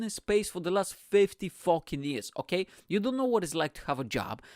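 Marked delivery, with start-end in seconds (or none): none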